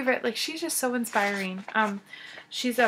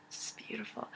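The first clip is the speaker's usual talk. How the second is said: breathless awed whisper; whispered in awe; 15 dB quieter than usual. Real, not acted. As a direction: hush it like it's precious. Gushing over knitted shawl.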